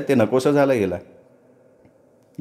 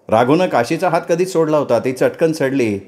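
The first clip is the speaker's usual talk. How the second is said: usual speaking voice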